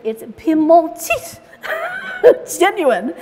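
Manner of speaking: put-on drawn-out French accent on "Piedmontite", laughing midway; mock French accent with a laugh, "Piedmontite" stretched and loud. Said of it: "She said it with a French accent, like Julia Childs".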